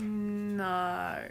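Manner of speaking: drawn-out New Zealand "No"; Kiwi accent. Shown as a demonstration. Teaching what the mouth does, drawn-out Kiwi accent. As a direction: stretch the Kiwi vowel in "No". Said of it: "now teach me technically".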